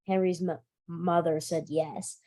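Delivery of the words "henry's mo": plain reading voice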